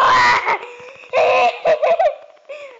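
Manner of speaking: high, loud shrieking laugh; screaming laugh. Real, not acted. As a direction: cackle and scream at once. Wild laugh in a fight.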